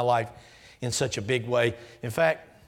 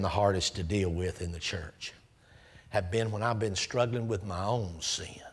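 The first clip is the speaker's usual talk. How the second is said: quiet confession; 6 semitones lower, 5 dB quieter than usual. Real, not acted. Confessing own struggles.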